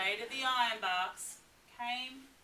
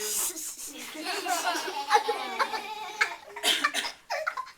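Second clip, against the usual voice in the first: long, higher villain laugh; high gleeful cackle; 8 semitones higher, 6 dB louder than usual. Acted. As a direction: cackle with glee. Dingo's laugh.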